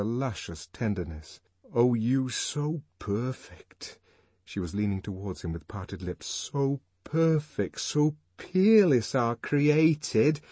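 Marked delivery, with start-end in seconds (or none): 1.7-4.0 slow, high, rapt verse; lovestruck, drawn-out declamation; adoring recital. A narrator's reading. Lover reciting verse in adoration. 6.3-10.4 slow, high, rapt verse; lovestruck, drawn-out declamation; adoring recital. A narrator's reading. Lover reciting verse in adoration.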